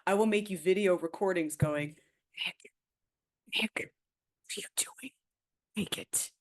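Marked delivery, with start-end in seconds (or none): 2.4-6.3 mock-menacing whisper. Friend threatens endless harassing video messages, in a mock-menacing whisper. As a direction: whisper it like a haunting nag.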